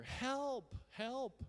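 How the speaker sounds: high-pitched, urgent re-enacted yell; loud acted cries for help. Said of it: "So I yelled louder".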